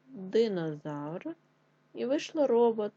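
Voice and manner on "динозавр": slow dictation. Shown as a demonstration. Teacher dictating the word slowly.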